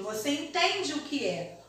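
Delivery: ordinary delivery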